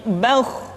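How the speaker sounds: "bauh" with a thick, rounded vowel before throaty kh; thick heavy kh. Shown as a demonstration. Teacher repeating the correct form, "bauh" said thick. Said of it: "dengan suara yang tebal".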